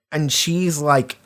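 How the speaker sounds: ordinary talk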